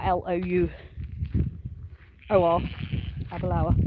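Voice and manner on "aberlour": Aberlour ending like "lower"; unsure pronunciation. Real, not acted. Newcomer repeating the place name to viewers, Aberlour said as "Aber-LOW-er". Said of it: "getting used to all the pronunciations".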